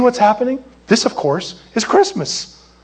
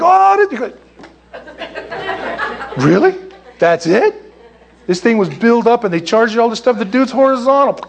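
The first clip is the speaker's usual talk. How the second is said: pitched-up disappointed fan, incredulous; mock disbelief; 5 semitones higher than usual. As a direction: play the cheated viewer who can't believe it. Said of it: "and you're like".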